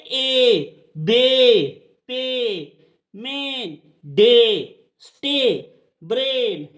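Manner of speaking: drawn-out ay in "ए, बे, पे, मेन, डे, स्टे, ब्रेन"; long ay sound. Shown as a demonstration. Teacher showing the long ए, drawn-out "ए, बे, पे, मेन, डे, स्टे, ब्रेन". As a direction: stretch each vowel of "ए, बे, पे, मेन, डे, स्टे, ब्रेन". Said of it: "ए का लंबा उच्चारण".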